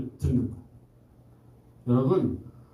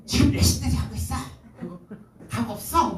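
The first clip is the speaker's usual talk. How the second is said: higher mock scold at a child; scolding parent; 5 semitones higher than usual. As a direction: play a fed-up parent snapping at a sleeping kid. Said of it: "입으로 확 갈아치면서".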